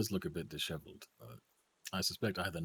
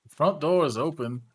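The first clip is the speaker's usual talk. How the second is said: alarmed shout; 8 semitones higher, 12 dB louder than usual. Acted. Alarmed in-character warning.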